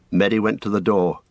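plain reading voice

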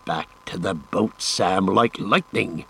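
slow, drawn-out "Back to the boat"; "boat" and "lightning" spoken with deliberate weight; dawning realisation. A narrator's reading. Sam realising where Frodo went, slow and emphatic. Sam's realisation, urging himself.

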